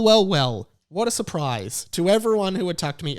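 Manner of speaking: ordinary delivery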